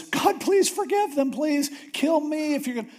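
high, pleading prayer in a retold voice; a hint of a frantic Moses begging God; mock desperate prayer. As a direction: throw yourself on God's mercy, lightly acted.

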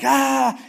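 high, loud furious yell of Moses; a sharp loud angry cry; angry roar. Retold or imitated. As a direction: let out a big, loud roar of rage. Moses smashing the tablets.

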